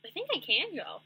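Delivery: high, bright reveal; excited. Retold or imitated. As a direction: announce it with rising glee. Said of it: "I was like".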